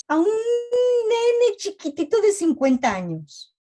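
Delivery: put-on high, childish voice for a grown man; baby voice. Mocking the fifty-year-old who acts like a toddler, baby voice. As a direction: sound like a little kid. Mocks adult as tiny child.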